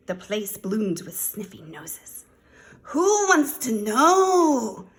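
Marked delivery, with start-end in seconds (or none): 2.8-4.9 high, loud, drawn-out challenge; mock growl. A narrator's reading. Voice inside the shack to a stranger, mock growling. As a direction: demand slowly and loudly. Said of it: "growled a voice from inside".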